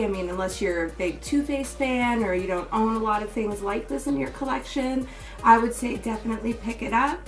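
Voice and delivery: usual speaking voice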